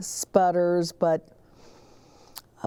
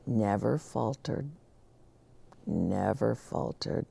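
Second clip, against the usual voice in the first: soft refrain; 7 semitones lower, 7 dB quieter than usual. A narrator's reading. Quiet closing refrain.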